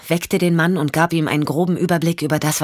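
usual speaking voice

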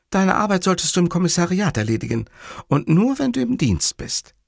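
high-pitched woman's reproach; cross Livia. Acted. Livia scolds Montalbano about work, high and cross.

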